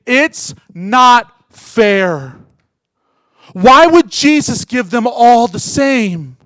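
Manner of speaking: rising from moderate to high and loud, slowing at the end; building sermon. Acted. Preacher urging the congregation to be astonished by grace, building loud. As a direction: build to a passionate peak.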